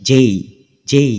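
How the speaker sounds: যেই as "jey", gliding into i; correct letter pronunciation. Shown as a demonstration. Teacher drilling the letter for learners, gliding pronunciation. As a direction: say যেই twice, each with a clear glide. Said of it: "এখানে আমরা কি শিখলাম?".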